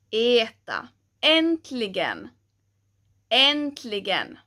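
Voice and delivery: "Äntligen" slow and clear, twice; word drill. Shown as a demonstration. Teacher modelling ä words, slowly. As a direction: stretch "Äntligen" evenly. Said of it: "the sound changes from an ä to an e".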